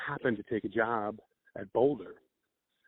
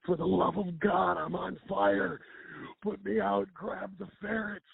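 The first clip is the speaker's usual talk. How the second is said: higher, slow, hammed-up frantic plea; mock panic; 7 semitones higher, slower than usual. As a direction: ham up a man begging to be put out. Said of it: "he's like".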